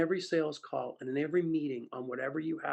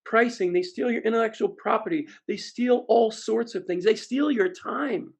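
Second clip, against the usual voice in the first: a touch of a raised crowd answer, slowing down; mock crowd answer; 8 semitones higher, 7 dB louder than usual. Audience calls out the buyer's sins, mild mock shout. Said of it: "They go".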